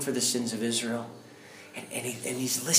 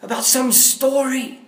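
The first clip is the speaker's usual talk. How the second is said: prophetic proclamation; 12 semitones higher, 9 dB louder than usual. Retold or imitated. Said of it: "the Holy Spirit, like, filled me".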